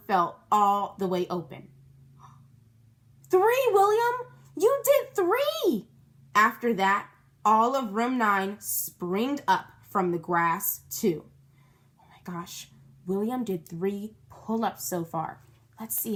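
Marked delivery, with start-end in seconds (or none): 3.3-5.9 high, loud, slowed shout; amazed kid shouting; thrilled cheer. A narrator's reading. Narrator cheering count. 12.0-12.7 hushed gasp; whispered amazement. Real, not acted. Aside to listeners.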